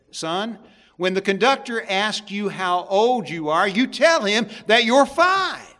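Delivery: mother instructing. Acted. Mother telling her son to lie about his age, firm. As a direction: set the rule for the boy, firmly. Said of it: "Mama looked down to her son and said".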